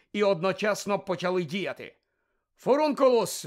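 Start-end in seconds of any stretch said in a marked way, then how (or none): 2.6-3.4 high, loud cry of an incantation; shouted spell. A narrator's reading. Harry cursing Malfoy in a duel, shouting. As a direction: fire the spell out at full voice. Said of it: "вигукнув Гаррі".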